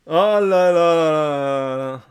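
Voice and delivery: slow, stretched oh là là; mock despair; drawn-out dismay. Real, not acted. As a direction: savour the disaster slowly. Dismayed at the outcome.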